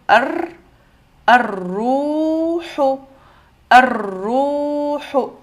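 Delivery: very slow "ar-ruhu" with a long rolled R; shadda sur ra. Shown as a demonstration. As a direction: stretch the doubled R and the U of "ar-ruhu". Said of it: "on voit shadda". (« ar-ruhu », R doublé, U allongé)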